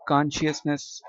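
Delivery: American "कॉन्शियसनेस" starting with an unrounded kahn; American-accent "कॉन्शियसनेस"; American pronunciation. Shown as a demonstration. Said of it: "American accent में".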